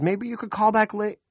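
high, loud, stressed woman; rushed, overwhelmed desk officer; harried dispatcher. A narrator's reading. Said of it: "she said".

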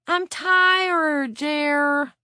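put-on high, slow whining like a tired kid; whiny child. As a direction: whine it like a worn-out little child. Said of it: "sounding like a whiny child".

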